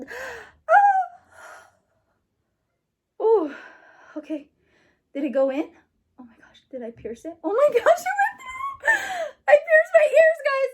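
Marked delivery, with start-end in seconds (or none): 0.1-1.7 high gasping cry; gasp and scream. Real, not acted. Girl feeling the needle go through, high gasping cry. The gun pierces. 7.4-10.7 high, loud laughing squeal; excited squeal. Real, not acted. Girl telling viewers she pierced her ears, high excited squeal.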